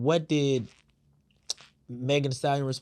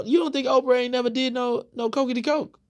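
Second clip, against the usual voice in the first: teasing jab; 11 semitones higher, 4 dB louder than usual. Real, not acted. Teasing rhetorical jab at Oprah.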